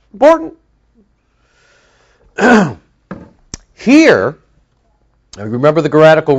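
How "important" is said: "Important" shot up high on a rising note; emphatic important. Real, not acted. Preacher driving home a grammar point, emphatic high rise. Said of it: "this is important".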